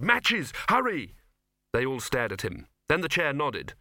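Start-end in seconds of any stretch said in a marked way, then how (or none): 0.0-1.2 high, urgent shouted order; sharp high-pitched urgent cry; shouting. A narrator's reading. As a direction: shout to hurry them along. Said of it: "Victor shouted".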